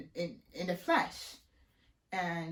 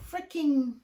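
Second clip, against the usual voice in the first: ordinary talk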